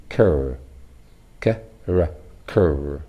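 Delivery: "cur" split into "k", "r", then joined; consonant blend. Shown as a demonstration. Teacher demonstrating a blend, "cur" broken apart and rejoined. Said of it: "includes the consonant blend".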